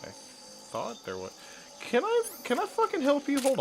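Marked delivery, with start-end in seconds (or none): 1.8-3.6 indignant. Acted. Snapping at enemy in game.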